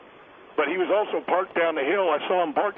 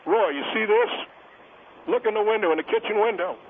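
calling out; 6 semitones higher than usual. Acted. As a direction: call out to someone outside. Calling out to neighbor outside.

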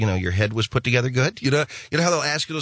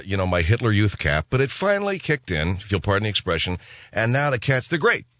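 ordinary delivery

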